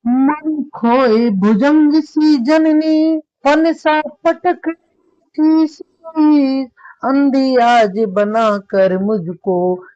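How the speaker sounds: very slow, high wailing verse; lamenting recitation. Acted. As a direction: stretch every word out as a grieving wail. (ऊँचे स्वर में धीमा विलाप पाठ)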